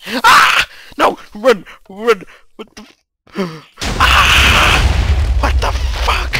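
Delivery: very loud, high panicked screams; terrified screaming. Real, not acted. Gamer fleeing a sudden scare, terrified screaming. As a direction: scream and shout to flee.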